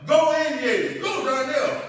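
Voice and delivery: high, loud retold cheering; cheering shout. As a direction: shout it like a dad calling from the sidelines. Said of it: "When you were saying".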